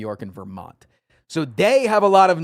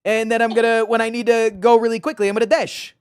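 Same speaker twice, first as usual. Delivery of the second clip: higher, louder, fast mock northern voice, "dish" said as "dash"; mock northern accent; 9 semitones higher, 5 dB louder, faster than usual. Mocking her own northern accent for a friend, hammed-up. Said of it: "it's like saying".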